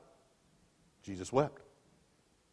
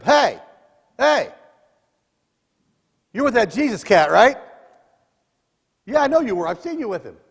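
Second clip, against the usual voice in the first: put-on loud, high accusing girl's voice; accusing servant girl; 9 semitones higher, 10 dB louder than usual. Servant girl calls out Peter by the fire, loud and high. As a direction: point him out to the courtyard.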